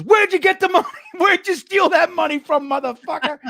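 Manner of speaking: high, loud, laughing mock yell; grandma shaking down a thief. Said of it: "she grabs him and goes".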